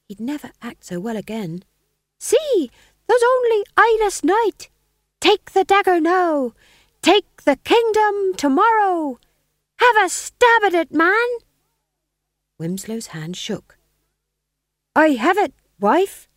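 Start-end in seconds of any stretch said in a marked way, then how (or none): 2.2-11.3 high, loud taunting wife; scheming wife voice. Acted. Gumridge as Lady Macbeth. 14.9-16.3 high, loud stage husband. Acted. Winslow in the play.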